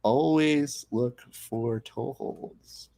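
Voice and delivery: very slow, measured dictation; deliberate teasing. Real, not acted. Host writes down a rule for co-host, slow deadpan. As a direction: spell out the lesson at a crawl. Mock lesson teasing co-host's wrong pick.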